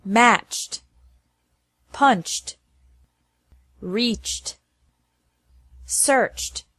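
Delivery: "matched, punched, reached, searched" each ending in a plain t sound; careful model words for learners. Shown as a demonstration. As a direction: say the ed as t, no extra syllable. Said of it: "ending with a C H sound".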